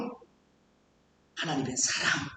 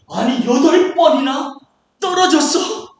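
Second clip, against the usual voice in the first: acted disbelief; 3 semitones higher, 7 dB louder, slower than usual. Exam taker aghast at failing eight times, acted disbelief. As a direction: exclaim in exaggerated shock at the count.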